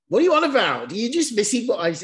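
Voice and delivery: high, loud incredulous outburst; loud retold exasperation at a friend's reply; exasperated yell. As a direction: shout it in disbelief. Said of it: "And I was like".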